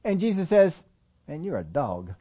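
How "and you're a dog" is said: low, hushed put-down; deadpan mock dismissal. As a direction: drop the insult quietly and bluntly. Acted blunt reply of Jesus.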